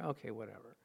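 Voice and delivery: low, soft mock sulk; pouting. Pastor offered a replacement coffee maker, quietly pouting. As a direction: mutter it in a reluctant pout. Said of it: "I just pouted".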